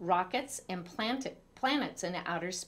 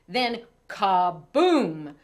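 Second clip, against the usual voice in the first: dramatic boom; 8 dB louder, much slower than usual. A narrator's reading. Narrating an explosion.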